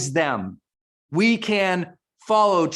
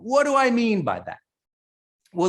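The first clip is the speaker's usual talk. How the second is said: normal talking voice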